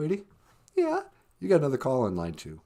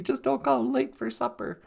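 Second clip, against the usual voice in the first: normal talking voice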